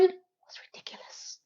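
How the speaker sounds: exasperated whispered verdict; indignant whisper. Real, not acted. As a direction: drop into a whisper of disbelief.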